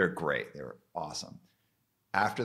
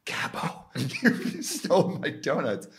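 higher, slow, laughing mock accusation; laughing punchline; 10 semitones higher, 6 dB louder, slower than usual. Real, not acted. Scolding Ray over stolen donuts, laughing mock accusation. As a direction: laugh as you accuse him.

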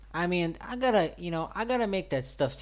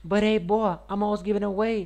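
higher, louder hype voice; hyped-up voice; 7 semitones higher, 4 dB louder than usual. Shown as a demonstration. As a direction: say it again with big energy. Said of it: "Spit it out".

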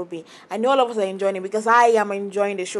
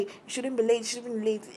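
mocking murmur; 9 dB quieter than usual. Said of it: "just there murmuring".

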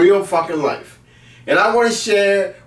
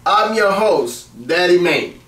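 ordinary talk, nothing marked

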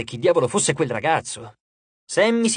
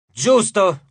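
loud, higher, commanding cry; imperious; 4 semitones higher, 6 dB louder than usual. A narrator's reading. Sammy approves the crowd's suggestion, imperious. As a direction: snap into a director's imperious shout. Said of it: "gridò Sammy in tono imperioso".

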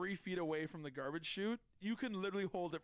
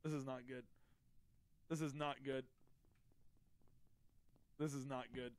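softer, lower worried repeat; muttered dread; 6 semitones lower, 8 dB quieter, faster than usual. Real, not acted. Streamer suspected by Cookie, soft low worried muttering. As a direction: mutter it to yourself, sinking.

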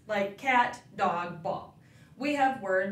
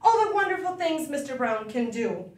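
ordinary delivery, nothing marked

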